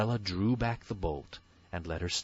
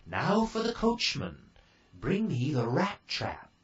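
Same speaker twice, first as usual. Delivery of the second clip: fairy godmother; 6 semitones higher than usual. A narrator's reading.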